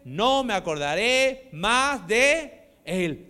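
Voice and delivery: loud, high, impassioned sermon voice; loud preaching with rising passion; fiery preaching. Acted. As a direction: preach it full force to the congregation. Preaches at full force.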